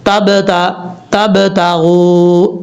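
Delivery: تب تاغو drawn out very slowly with a bounce on the ب; teacher reciting تب تاغو correctly; slow correct recitation. Shown as a demonstration. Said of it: "اس طریقے سے".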